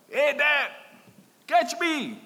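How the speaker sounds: high, loud call to dad; imitated child's yell. Boy calling to his dad from the rocks above, acted yell. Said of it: "I heard a voice from above me yell".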